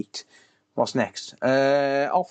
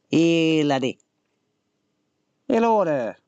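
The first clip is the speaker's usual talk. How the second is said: mock Yorkshire, higher pitched; put-on northern accent; 4 semitones higher, slower than usual. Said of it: "I don't know what accent he's supposed to be".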